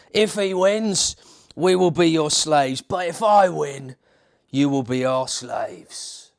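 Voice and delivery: loud, slow Cockney bellow; Cockney brute calling out a challenge; Cockney giant. Acted. Goliath challenging the army in a loud Cockney voice. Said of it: "was a Cockney from East End of London".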